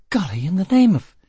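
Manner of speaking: high, loud exclamation, cut off; startled woman. A narrator's reading. Prisoner to the man who burst into her cell, startled. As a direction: exclaim in high-pitched astonishment.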